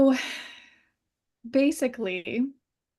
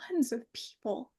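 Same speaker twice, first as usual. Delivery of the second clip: teary; 8 dB quieter than usual. Real, not acted. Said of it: "get teary about this".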